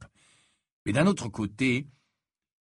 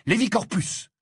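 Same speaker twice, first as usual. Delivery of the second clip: sudden high, loud spell; cried incantation; 12 semitones higher, 5 dB louder than usual. A narrator's reading. Harry casts a spell on his attacker, crying out. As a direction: fire off the incantation forcefully.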